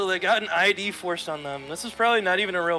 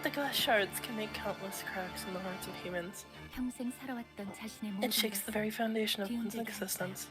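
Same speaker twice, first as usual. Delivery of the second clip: soft, measured character read; hushed dramatic reading with careful pauses; quiet game character; 9 dB quieter than usual. Acted. Reads game dialogue in character.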